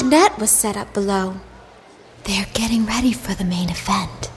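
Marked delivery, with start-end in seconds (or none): 2.2-4.3 soft unvoiced whisper. A narrator's reading. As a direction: whisper it into her ear. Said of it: "Mommy whispered in Starry's ear".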